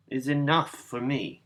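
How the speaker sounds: usual speaking voice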